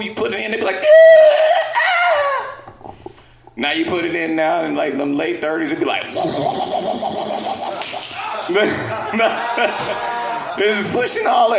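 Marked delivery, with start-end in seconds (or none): 0.8-2.9 shrill, loud high-pitched cry; a squealing mock gasp. Woman's gasp in a story. 6.1-10.5 a long breathy farting wheeze; mock wheezing push. Imitated air sound.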